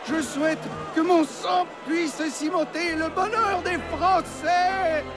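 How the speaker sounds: high, drawn-out declamation in French; last speech to the crowd. Acted. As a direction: cry it out to the whole people. Condemned man addresses the crowd.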